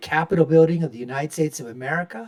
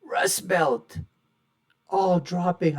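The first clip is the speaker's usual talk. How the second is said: ordinary talk, nothing marked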